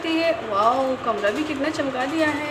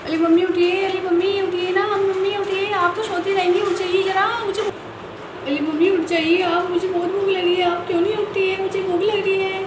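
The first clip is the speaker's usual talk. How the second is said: put-on higher, whining little girl; whiny child; 3 semitones higher, 3 dB louder than usual. Chinki whining to wake her sleeping mummy, higher.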